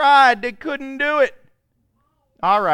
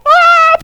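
mock wrestler yell; 16 dB louder than usual.

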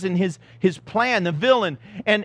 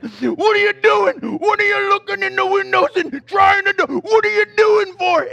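loud accusing yell at a stranger; 13 semitones higher, 6 dB louder than usual. Retold or imitated. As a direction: bellow at the suspect like a hero. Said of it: "I'm yelling at him".

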